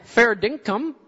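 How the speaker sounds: high, loud confused American voice; baffled American. Acted. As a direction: play the bewildered American, pitched high.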